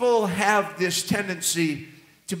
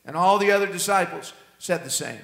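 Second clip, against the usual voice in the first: ordinary delivery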